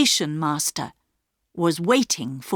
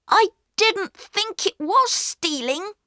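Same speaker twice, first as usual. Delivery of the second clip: much higher, earnest explaining; pleading boy; 14 semitones higher than usual. A narrator's reading. Peter explaining to the station master, pleading. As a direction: plead his case. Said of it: "said Peter".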